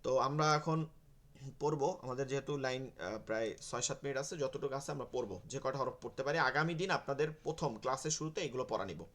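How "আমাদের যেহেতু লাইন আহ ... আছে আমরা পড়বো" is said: ordinary talk, nothing marked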